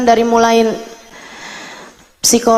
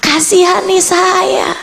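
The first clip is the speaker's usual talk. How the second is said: retold pleading prayer; 7 semitones higher than usual. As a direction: implore God, stretching the words. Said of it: "Saya kemudian mulai berdoa". (meratap memohon dalam doa)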